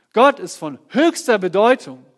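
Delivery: "Gott ist von höchster Bedeutung" ringing out loud and high; preacher's booming declaration; loud proclamation. Acted.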